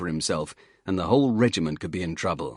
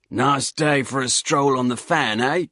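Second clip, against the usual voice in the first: higher, louder cheery Cockney greeting; gravelly Cockney accent; 5 semitones higher, 4 dB louder than usual. Acted. Hiker greets the soldiers in a loud Cockney accent. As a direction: hail them in a rough Cockney voice. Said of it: "in a gravelly accent".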